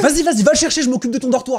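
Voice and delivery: high, loud orders; frantic urging. Acted. Colleague sending him after the runaway, frantic. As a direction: send him off at once, high and urgent.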